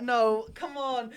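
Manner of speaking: normal talking voice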